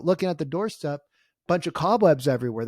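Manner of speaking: normal talking voice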